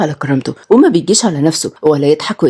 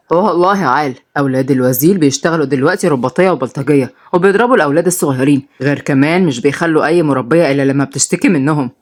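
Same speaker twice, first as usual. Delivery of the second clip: lower, scolding father voice; stern father; 6 semitones lower than usual. Acted. The minister scolds his twins, lower and stern. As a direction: lecture the kids from above.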